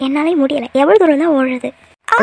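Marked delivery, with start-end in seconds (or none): 2.1-2.2 sudden short cry as she falls; a startled yelp mid-tumble; falling cry. Acted. Girl running off stumbles and cries out sharply.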